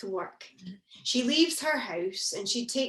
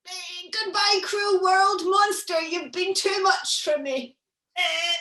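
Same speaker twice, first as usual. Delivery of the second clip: hammed-up dying farewell, a touch higher; fake dying; 7 semitones higher, 5 dB louder than usual. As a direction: overplay a feigned death. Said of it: "feign a very dramatic heart attack".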